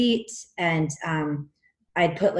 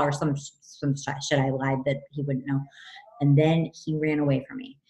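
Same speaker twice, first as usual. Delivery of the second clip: ordinary talk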